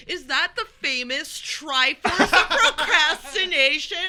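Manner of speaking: loud, high, drawn-out mock announcer call; screamed call-out. Friends teasing her across the convention, screamed in a put-on voice. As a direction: holler it slowly across a crowded hall, imitating teasing friends. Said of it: "they scream across the convention".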